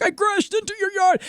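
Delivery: put-on high, whiny drunk protest; drunk driver. Drunk man to angry homeowner, high whiny protest. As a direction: plead like the drunk who crashed.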